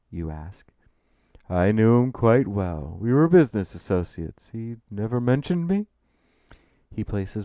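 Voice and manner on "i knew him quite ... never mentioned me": put-on refined older gentleman, loud and emphatic; Gabriel Jayfield. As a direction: project like a stately old acquaintance. Narrator voices Gabriel.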